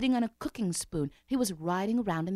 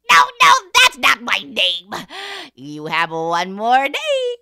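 loud, slow gloating imp; little man voice; 4 semitones higher, 15 dB louder, slower than usual. Acted. The imp warns the queen of one more day, loud. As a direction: lord it over her like a cheeky imp.

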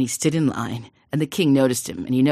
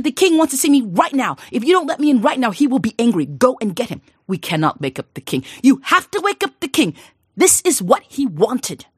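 insistent daughter; 9 semitones higher, 6 dB louder than usual. Acted.

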